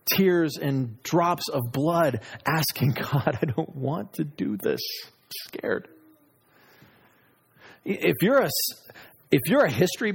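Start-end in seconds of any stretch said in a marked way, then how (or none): 3.4-5.9 hushed, frightened prayer, sinking to a whisper; retold fear, trailing into a whisper; scared plea. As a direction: voice a frightened plea to God, fading almost to nothing. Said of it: "I am scared to death".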